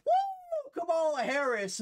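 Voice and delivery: mocking a supporter's big cheer. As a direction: squeal a fake fan's woo, then say the name. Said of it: "There's no energy where it's like".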